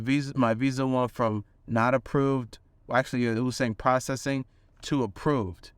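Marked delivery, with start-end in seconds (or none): none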